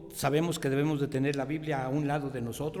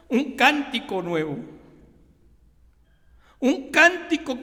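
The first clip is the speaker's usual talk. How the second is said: louder, rising scripture proclamation; preaching; 4 semitones higher, 4 dB louder than usual. Acted. Pastor proclaiming the psalm to his congregation, fervent. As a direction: proclaim the psalm with building fervor.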